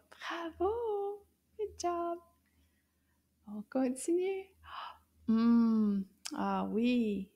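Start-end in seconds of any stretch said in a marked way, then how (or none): none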